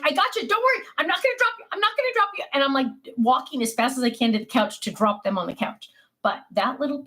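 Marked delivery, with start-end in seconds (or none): 0.0-2.4 reassuring. Retold or imitated.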